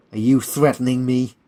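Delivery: loud, affronted challenge; menacing challenge. Acted. Colonel to Stanley after a threat, menacing. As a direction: confront the threat head-on.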